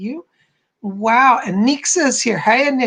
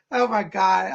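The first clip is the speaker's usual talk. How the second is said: ordinary delivery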